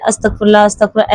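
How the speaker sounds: imitating a hurried अस्तगफिरुल्लाह with the syllables slurred together; mock the careless quick way sisters say अस्तगफिरुल्लाह; rushed sloppy astaghfirullah. Preacher imitating sisters who gabble it, rushed and slurred. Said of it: "मेरी बहन पढ़ती है".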